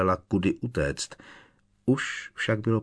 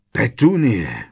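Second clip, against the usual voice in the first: loud, higher call of her name, falling; insistent call; 4 semitones higher, 8 dB louder, slower than usual. A narrator's reading. Strýc Vernon demands Petunie explain, insistent call. Uncle demands answer from wife.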